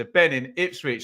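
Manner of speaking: ordinary talk, nothing marked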